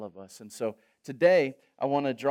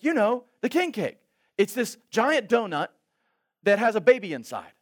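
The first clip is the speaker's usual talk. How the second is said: high, loud, breathless rattling explanation; excited friend; 9 semitones higher, 7 dB louder than usual. Retold or imitated. New Orleans friend explaining Fat Tuesday, high and excited. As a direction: gush it out like it's your holiday.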